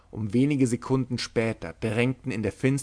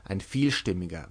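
ordinary delivery, nothing marked

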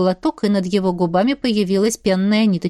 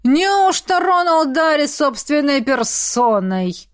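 slow, higher, theatrical hail; booming baron; 6 semitones higher, slower than usual. A narrator's reading. Baron spots an old acquaintance, booming and drawling. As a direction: greet him grandly, drawing out every word. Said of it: "громогласное откуда-то справа".

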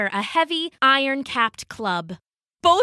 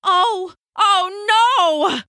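high, loud wail of dismay; horrified cry; 9 semitones higher, 5 dB louder, much slower than usual. Acted. Haddo seeing the mouse-filled dining hall, horrified cry. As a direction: gasp it out in mounting dread, pitched up. Haddo sees room full of mice.

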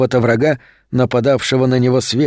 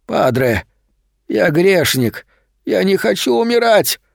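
desperate dying plea; 7 semitones higher than usual. A narrator's reading. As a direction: voice rising in panic, pitch climbing high. Dying man cries out.

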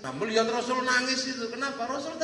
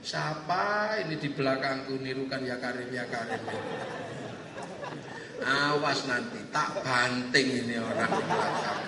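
low grumbling threat; acted grumble, well below your usual pitch; muttered threat; 10 semitones lower, 3 dB quieter than usual. Pilgrim grumbling about whoever copies him from behind, low threat.

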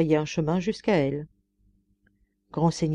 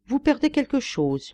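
a rough, cracked voice, pitched higher; hoarse, broken voice; 8 semitones higher than usual. A narrator's reading. Old beggar woman calls to Sophie, hoarse and broken. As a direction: rasp it out as if the voice were breaking. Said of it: "une voix rauque qui semblait brisée".

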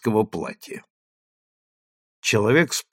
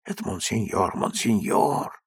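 much softer, low whisper; whispering crowd; 4 semitones lower, 8 dB quieter than usual. A narrator's reading. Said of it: "в толпе зашептали".

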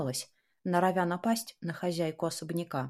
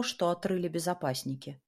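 ordinary delivery

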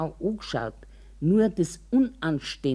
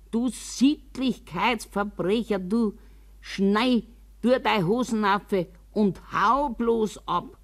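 higher, indignant mock yell; retold scream; 6 semitones higher than usual. Old woman retelling how she yelled at a flasher, mock scream. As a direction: scold him off with raised pitch, not full volume. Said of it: "Ich hab geschrien".

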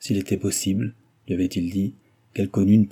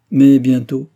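louder, punched warning; emphatic retort; 5 semitones higher, 11 dB louder, slower than usual. A narrator's reading. Doctor warning milady about her cousin, in an emphatic retort. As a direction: snap it back at her.

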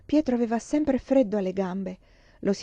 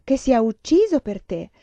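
raised, emphatic cry of insistence; eager outburst, pitched up; 4 semitones higher, 6 dB louder than usual. A narrator's reading. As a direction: cry it out, punching the key word louder and higher. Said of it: "gridò Olga".

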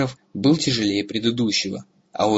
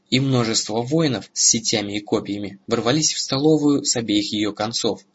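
ordinary delivery

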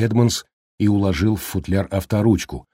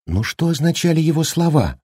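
higher-pitched, insistent asking; pressing question; 9 semitones higher than usual. A narrator's reading. Mother presses doctor about son's words, higher and insistent. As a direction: press gently for an answer. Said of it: "допытывалась Уэнди".

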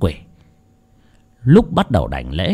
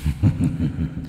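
low, soft demonic cackle; 7 semitones lower, 9 dB quieter than usual. Acted. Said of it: "Con quỷ vẫn cười khành khạch".

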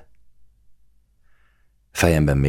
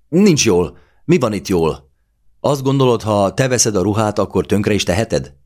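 much higher and louder angry accusation; furious outburst; 7 semitones higher, 5 dB louder than usual. A narrator's reading. Claudia furiously throwing the accusation back at her lover. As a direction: shout the accusation at him, outraged.

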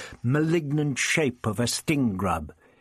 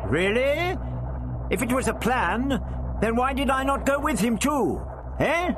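high, challenging questions; indignant retort; 12 semitones higher than usual. A narrator's reading. Doctor challenging the accusing voice, indignant. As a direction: argue back high-pitched and testy. Doctor argues back in quarrel.

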